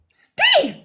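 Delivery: very high, mock-amazed exclamation; acting out thrilled surprise; retold impressed cry. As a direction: call his name in high delight. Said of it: "I was like".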